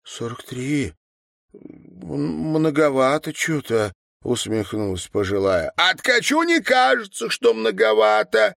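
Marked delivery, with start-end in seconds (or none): 0.0-3.9 astonished question. A narrator's reading. Woman astonished by the figure. 5.8-8.5 high, loud, outraged voice; shrilly raised accusing voice; indignant retort. A narrator's reading. Old man's indignant retort.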